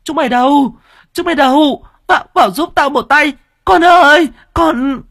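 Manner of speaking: very high, loud frantic shrieks; panicked mother yelling for help; screaming for help. A narrator's reading. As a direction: scream for the servants to come in. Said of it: "la hét oai oái".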